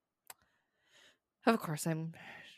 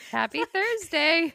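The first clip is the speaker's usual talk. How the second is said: loud, high, drawn-out sing-song; cheery greeting; 11 semitones higher, 8 dB louder, slower than usual. Real, not acted. Cheerful show sign-off greeting.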